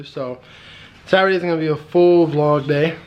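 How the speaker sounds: usual speaking voice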